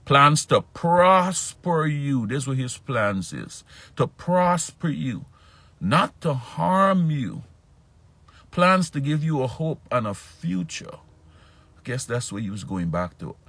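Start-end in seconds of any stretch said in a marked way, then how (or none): 0.1-11.0 high, loud, slow sermon delivery; preaching. Acted. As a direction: proclaim the promise to the congregation. Preaching the promise.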